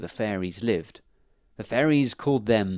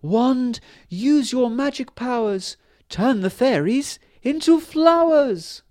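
high, slow, louder commanding fairy voice; queen ordering her wand to work magic; Fairy Queen casting a spell; 13 semitones higher, 7 dB louder, slower than usual. Acted. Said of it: "she said".

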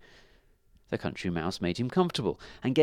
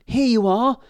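high, loud call; helpful dove calling down to the ant; 13 semitones higher, 10 dB louder than usual. A narrator's reading. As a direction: call it out high to someone below. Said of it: "she called".

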